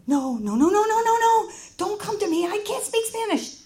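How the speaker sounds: high, loud, rapid string of refusals; mock panic. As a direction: fend someone off in hammed-up alarm. Retold panic as woman approaches her.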